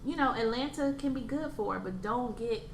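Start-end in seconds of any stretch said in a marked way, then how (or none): none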